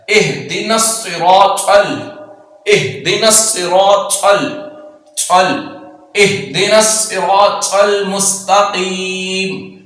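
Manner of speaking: الصراط with a full, heavy ta; heavy taa. Shown as a demonstration. Teacher drilling the heavy ta. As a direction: thicken the ta in الصراط. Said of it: "اس کو پر پڑھا جاتا ہے".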